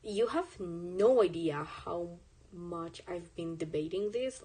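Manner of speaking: usual speaking voice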